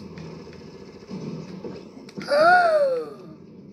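high, loud startled gasp; loud shocked gasp. Real, not acted. Sudden game event.

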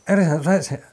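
imitating incomprehensible speech; garbled accent imitation. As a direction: blurt it as an unintelligible jumble. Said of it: "someone's having a stroke".

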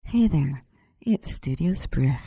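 normal talking voice

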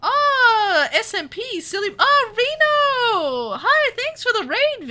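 loud, high squeal of delighted surprise; excited greeting. Real, not acted. Streamer welcomes a surprise raid from a fellow creator, thrilled.

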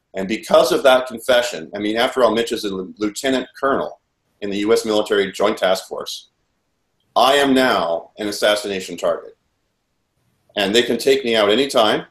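ordinary delivery

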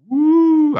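long, high "wooo!"; loud, drawn-out celebratory howl; showy whoop. Pretend. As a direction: whoop like a boasting wrestling champ. Wrestling-style brag about title belt.

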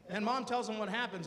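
usual speaking voice

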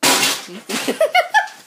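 loud, high-pitched laugh; high laughing burst. Real, not acted. Vlogger laughing while destroying the room, high loud laugh.

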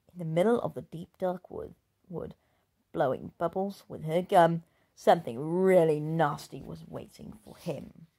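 ordinary delivery, nothing marked

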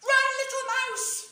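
high, loud urgent shout. A narrator's reading. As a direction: yell a warning, run!. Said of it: "shouted the squirrel and the fox and the badger and the hare".

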